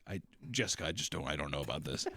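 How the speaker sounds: low, quiet doubtful dad; hesitant dad. Pretend. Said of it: "the dad's like".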